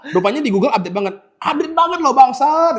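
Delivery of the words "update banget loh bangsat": high, disbelieving cursing exclamation; amazed outburst. Acted. Fan amazed by what Google knows, high amazed outburst. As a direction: blurt it out high, half amused and amazed.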